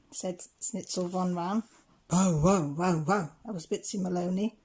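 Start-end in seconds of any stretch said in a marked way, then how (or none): 2.1-3.3 dog barking. A narrator's reading. Bitsy Maloney barks.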